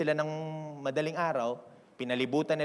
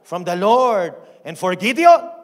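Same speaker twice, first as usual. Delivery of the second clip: slow, high war shout; a preacher acting out a battle cry; 8 semitones higher, 16 dB louder, slower than usual. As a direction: bellow it like soldiers charging. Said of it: "At sumigaw sila".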